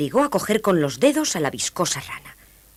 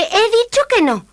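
high, loud refusal; princess shout; 7 dB louder than usual. A narrator's reading. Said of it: "gritó la princesa". (princesa que grita con voz aguda)

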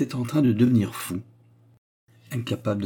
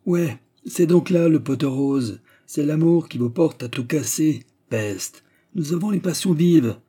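loud, high teasing banter; mock the lovesick boy; 6 semitones higher, 4 dB louder than usual. A narrator's reading. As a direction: steward mocking the youth.

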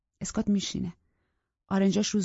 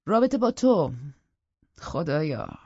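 louder, incredulous stress on تو; scornful disbelief; 3 semitones lower, 6 dB louder, slower than usual. Acted. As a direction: sneer at the idea. (ناباوری تمسخرآمیز روی «تو»)

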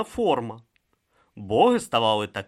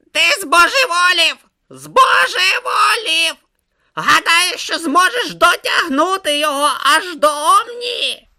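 loud, high-pitched screeching tirade; outraged shouting; 11 dB louder than usual. A narrator's reading. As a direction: shriek in disbelief at a companion's madness. Om rants that Brutha is mad.

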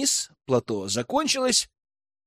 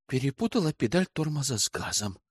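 softer, resigned admission; doomed sigh; 6 dB quieter than usual. A narrator's reading. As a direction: sigh it out hopelessly. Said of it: "обречённо вздохнула девушка".

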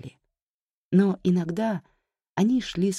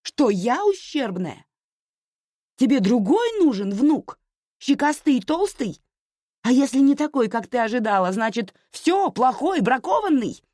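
high, loud, outraged questions; hurt daughter shouting at her mother; yelling; 11 semitones higher, 6 dB louder than usual. A narrator's reading. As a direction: hurl each question as an accusation. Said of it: "заорала она на всю улицу".

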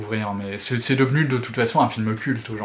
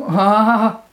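higher, louder shocked ah; short sharp ah of revulsion; disgusted cry; 9 semitones higher, 8 dB louder than usual. Acted.

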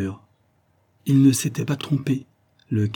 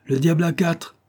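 cried out; 8 semitones higher than usual. A narrator's reading. Customs man answers the sentry, crying out. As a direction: cry it back sharply higher. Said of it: "cria le douanier".

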